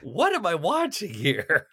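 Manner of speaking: bewildered, as if the scene makes no sense; incredulous disbelief. Retold or imitated. Viewer baffled by a parking garage sword fight, incredulous. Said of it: "And you're just like".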